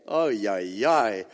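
normal talking voice

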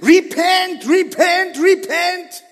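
loud high call to repent; repeated loud call. Acted. Peter calling the crowd loudly to repent. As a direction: hammer each repent.

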